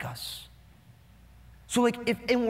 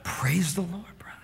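hushed voice; 2 semitones lower, 5 dB quieter than usual. Retold or imitated. Mocking hushed pastors at a conference, pious whisper. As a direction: mock-holy whisper. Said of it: "They'd pray in a hushed voice".

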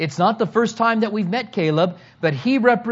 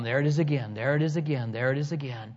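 fading to a hush; 6 semitones lower, 7 dB quieter, faster than usual. Acted. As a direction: repeat it, softer each time until barely heard. Repeated line fading to softness.

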